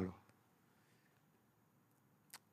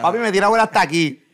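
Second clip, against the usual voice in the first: loud, laughing complaint; giggling and loud; 8 semitones higher, 12 dB louder than usual. Real, not acted. Laughing while telling grandma story.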